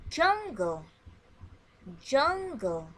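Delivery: loud clear model word said twice for echo; teacher modelling a vocabulary word. Shown as a demonstration. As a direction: call out the word for the class to repeat. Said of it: "Listen and repeat after me".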